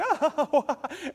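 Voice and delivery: high burst of laughter; real laugh. Real, not acted. Preacher laughing at his own story, real laugh. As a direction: laugh out at the memory.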